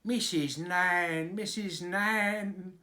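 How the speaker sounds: slow, high wailing call; wailing dairymaid. A narrator's reading. Dairymaid flying in, wailing for the nurse. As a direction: wail her name, drawn out.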